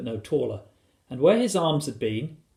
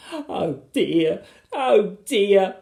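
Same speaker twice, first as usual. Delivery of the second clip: high, wailing lament; acted storybook weeping; sobbing; 7 semitones higher, 5 dB louder, much slower than usual. A narrator's reading. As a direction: moan it tearfully. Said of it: "she sobbed".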